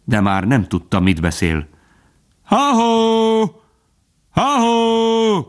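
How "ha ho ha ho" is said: high, drawn-out hallooing call; sustained high-pitched cry, held on one note. A narrator's reading. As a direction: send a long hunting call through the woods. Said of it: "hangzott fel most a közelből".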